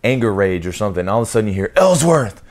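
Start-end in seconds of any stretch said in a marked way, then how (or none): 1.8-2.4 loud, high drill shout of a name; barked name. Retold or imitated. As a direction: call him out across the mess hall. Petty officer barks name.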